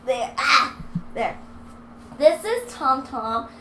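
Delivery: usual speaking voice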